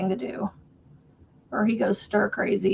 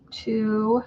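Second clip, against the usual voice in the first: normal talking voice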